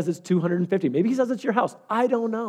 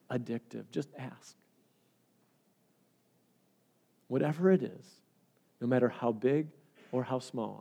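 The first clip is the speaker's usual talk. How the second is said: closing prayer invitation; 3 semitones lower, 5 dB quieter than usual. Real, not acted. Preacher asks congregation to bow heads and pray, softly prayerful. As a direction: ease the congregation gently into prayer, with long pauses.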